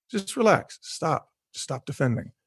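quiet, soothing coaxing. Acted. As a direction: softly urge letting go. Soothing urge to let go.

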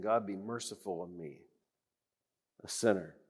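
the humble tax collector praying low and quietly; hushed humble plea. Acted. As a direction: murmur it like a broken man begging. Prayer voiced, low and hushed.

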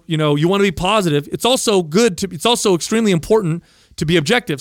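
ordinary talk

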